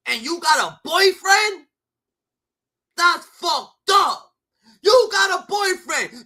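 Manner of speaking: slow, high, loud outraged yelling; angry shout. Real, not acted. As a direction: drag each word out in a furious shout. Angry rant at the cheering crowd.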